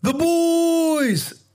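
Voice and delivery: high loud drawn-out call; hype catchphrase. Real, not acted. Host opens the show with a high loud call. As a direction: belt out the show name.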